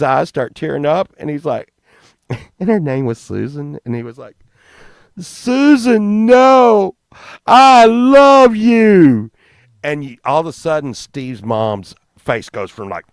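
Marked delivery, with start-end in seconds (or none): 5.2-9.3 high, loud, slow wailing plea; hammed-up lovesick wail; tearful plea. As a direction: wail it like a heartbroken teen. Steve tearfully pleading.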